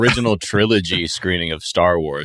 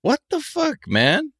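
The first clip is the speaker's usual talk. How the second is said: baffled disbelief; 4 dB quieter, much slower than usual. Real, not acted. Astonished at award news.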